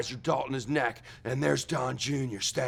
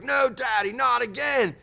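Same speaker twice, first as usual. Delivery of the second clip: pleading son; 8 semitones higher, 4 dB louder than usual. Retold or imitated. Son begs the transforming father.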